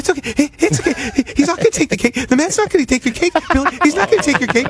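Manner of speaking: high, sing-song mom voice calming her son; soothing mom. Retold or imitated. Imitating the mother calming her screaming son. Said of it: "And the mom's like".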